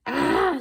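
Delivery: loud, high yell of frustration; frustrated scream. Acted. Principal frustrated with secretary, loud yell. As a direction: let out a short furious yell. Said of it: "screamed Mister Crow".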